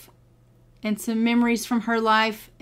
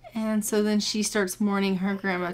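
ordinary talk

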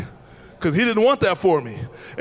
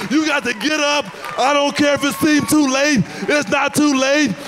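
high, drawn-out preacher's exhortation; preaching; 4 semitones higher than usual. Real, not acted. Preacher urges people to get up and get out, exhorting. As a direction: preach it high, phrase by phrase, with stretched endings.

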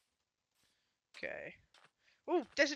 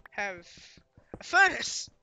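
triumphant exclamation; 8 dB louder, slower than usual. Real, not acted. Sudden triumphant realization.

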